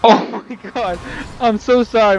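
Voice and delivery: flustered apology. Real, not acted. Apologising after controller crash.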